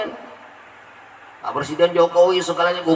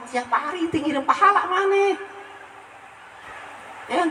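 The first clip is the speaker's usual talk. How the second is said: lightly voiced prophet; 10 semitones higher, 5 dB quieter than usual. Voicing Rasulullah to spitter.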